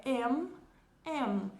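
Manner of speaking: "M" fechando os lábios no final; "M" com boca fechada no fim; full English M. Shown as a demonstration. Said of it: "a letra M vai acontecer a mesma coisa". ("M" pronunciado por inteiro)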